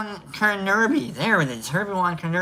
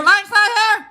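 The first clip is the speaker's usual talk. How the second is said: very high, loud repeat; high comic holler; 12 semitones higher, 5 dB louder than usual. Acted. Host jokes about an absent viewer, high comic holler. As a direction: call it out in a raised, high voice.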